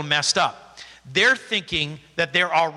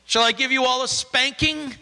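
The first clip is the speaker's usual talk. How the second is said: mock stern leader; 7 semitones higher than usual. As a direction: play the stern preacher for a laugh. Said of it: "a leader up here saying".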